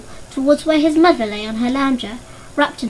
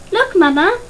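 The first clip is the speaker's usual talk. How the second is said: calling out; 7 semitones higher, 5 dB louder than usual. A narrator's reading. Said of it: "she called out".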